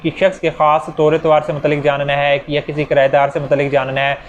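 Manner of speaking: ordinary delivery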